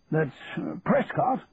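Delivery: hesitant colonel, lightly voiced; a hint of an officer pausing; military officer. A narrator's reading.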